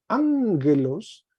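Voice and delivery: ángelos, slowly, the first gamma sounding like an N; slow demo. Shown as a demonstration. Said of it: "la primera suena como una N". (ángelos, despacio, la gama como N)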